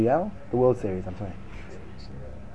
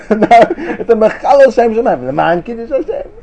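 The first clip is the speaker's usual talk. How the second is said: laughing; 6 semitones higher than usual. Real, not acted. Laughing repeat.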